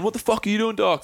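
high-pitched mock telling-off; abusive greeting. Old mate sits down and starts abusing him. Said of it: "Starts abusing me".

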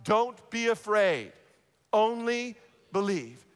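slow, deliberate phrase for repeating; measured, emphatic call to repeat; leading a recitation. Shown as a demonstration. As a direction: lead the congregation word by word. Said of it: "Say that with me out loud".

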